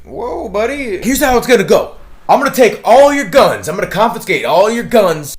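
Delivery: high, loud hammed-up threat; mock sheriff threat. Imitating the sheriff threatening to take their guns, loud and high. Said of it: "And I was like".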